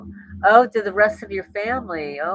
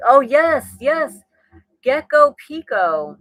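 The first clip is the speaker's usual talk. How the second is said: bright, loud and high greeting; excited shout-out; 6 semitones higher, 6 dB louder, slower than usual. Real, not acted. Giving a viewer a requested shout-out, excitedly. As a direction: greet the viewer with a burst of delight.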